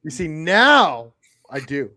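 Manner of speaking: teasing, swooping emphasis on "now"; drawn-out stress. Retold or imitated. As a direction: lean hard and slowly on "now". Mimicking the other's change of heart.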